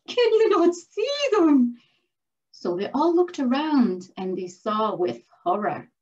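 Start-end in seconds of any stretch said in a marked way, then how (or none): 0.1-1.8 high, loud, frantic question; panicked plea. A narrator's reading. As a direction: urge them to look, alarmed. Birch still panicking at dragons.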